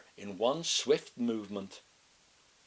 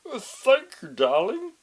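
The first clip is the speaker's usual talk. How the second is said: wolf faking a high, loud granny voice, hesitant; disguised voice; 11 semitones higher, 11 dB louder than usual. A narrator's reading. Wolf pretending to be grandma, clumsy disguised voice. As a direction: clear throat and fake an old lady badly.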